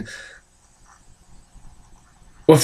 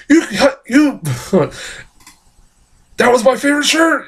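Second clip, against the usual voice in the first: high-pitched, fast mock yell at the biker; Nicolas Cage yelling; 9 semitones higher, faster than usual. Cage yelling at the demonic biker who cut his shirt, high mock shriek. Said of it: "like, yelling at him".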